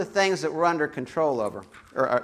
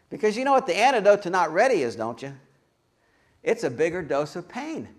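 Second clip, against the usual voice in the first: ordinary talk, nothing marked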